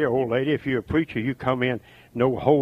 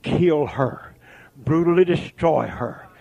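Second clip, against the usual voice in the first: ordinary delivery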